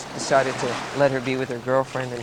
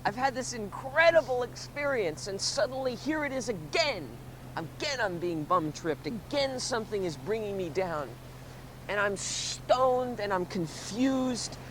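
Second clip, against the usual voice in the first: soft, higher-pitched lament; acted woe; 5 semitones higher, 7 dB quieter than usual. Stoned teller bum tripped again, soft high lament. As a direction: complain to the heavens in a small high voice.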